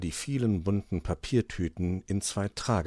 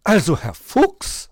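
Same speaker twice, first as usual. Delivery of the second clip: high, louder offended protest; indignant; 12 semitones higher, 11 dB louder, slower than usual. Acted. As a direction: take offence as the magpie. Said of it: "sagte die Elster".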